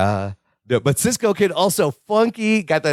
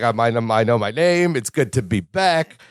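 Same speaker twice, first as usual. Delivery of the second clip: imitating Larry; 7 semitones lower than usual. Host voices relieved Larry, lower. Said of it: "He's like".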